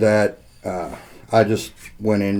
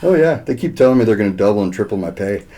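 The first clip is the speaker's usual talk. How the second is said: ordinary talk, nothing marked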